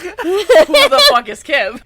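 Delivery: loud, high, drawn-out disbelief; baffled yell; exasperated shout. Real, not acted. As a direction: belt it out slowly, demanding an answer. Repeats question louder, in exasperation.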